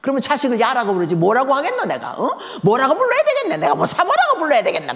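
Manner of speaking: high, loud indignant outburst; mother fuming alone in the kitchen, loud and high; angry rant. Retold or imitated. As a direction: rant at nobody in particular. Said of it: "갑자기 화가 또 나시는 거야".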